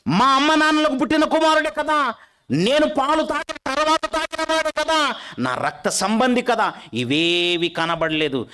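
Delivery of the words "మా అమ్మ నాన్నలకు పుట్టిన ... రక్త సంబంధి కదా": high, loud, rhythmic repetition; fiery preaching. Acted. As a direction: preach it with rising fervour. Preaching at full force.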